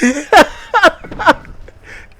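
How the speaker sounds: high loud cackling laugh; real laughing fit; shrieking laugh. Real, not acted. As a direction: burst into a screaming laugh. Laugh after reveal.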